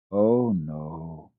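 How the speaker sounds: a touch of a sigh in it; mildly resigned little sigh. A narrator's reading.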